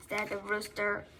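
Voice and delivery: ordinary delivery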